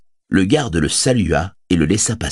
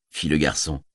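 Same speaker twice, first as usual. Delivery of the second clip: plain reading voice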